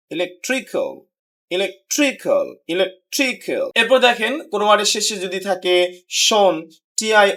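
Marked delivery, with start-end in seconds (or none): 0.1-3.7 "electrical" with stress on tree; stress before ending. Shown as a demonstration. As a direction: lean on tree in "electrical", falling after. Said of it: "শেষের আগের সিলেবলটাকে". ("ইলেকট্রিক্যাল"-এর ট্রি-তে জোর)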